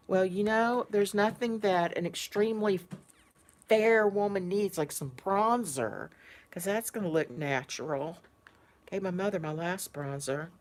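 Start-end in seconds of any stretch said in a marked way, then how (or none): none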